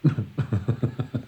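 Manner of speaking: scoffing chuckle before answering; amused, dismissive chuckling; dismissive laugh. Acted. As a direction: laugh off the request for money. Uncle laughs off money request.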